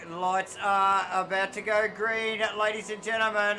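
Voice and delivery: loud, high race start call; race announcer. Acted. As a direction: hype the lights going green.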